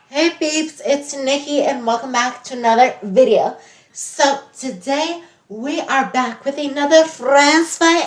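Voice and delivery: lightly voiced interview host; bubbly talk-show host. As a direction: welcome the guest like a reality show host. Acts as upbeat show host.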